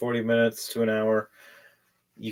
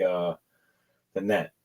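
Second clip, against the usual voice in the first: ordinary delivery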